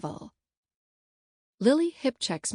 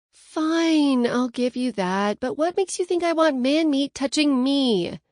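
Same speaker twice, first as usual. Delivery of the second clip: louder, higher-pitched note of disgust; 5 semitones higher, 4 dB louder, slower than usual. A narrator's reading. Bridesmaid giving in to the bride over the sash, disgusted. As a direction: give in with evident disgust. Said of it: "disgust evident in my tone".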